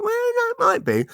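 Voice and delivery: very high-pitched acted protest; squeaky mock reply. Husband answering his doubting wife, very high mock voice. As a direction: send the voice way up, mock-hopeful.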